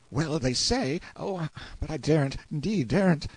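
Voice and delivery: put-on high boy's voice, faltering; fearful reluctance. A narrator's reading. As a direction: start to tell, then back away in fear. Frightened boy dares not tell.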